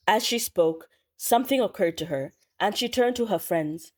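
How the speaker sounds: plain reading voice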